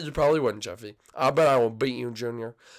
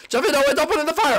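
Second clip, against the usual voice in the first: fast, high, loud plea; panicked shout; 12 semitones higher, 5 dB louder, faster than usual. Acted. Junior stops Jeffy burning the Switch, panicked shout. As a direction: shout urgently to stop them.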